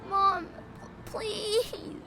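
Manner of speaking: high, grief-stricken plea; tearful pleading. Acted. Son to dying mother, tearful pleading. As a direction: plead brokenly, drawing out the last word.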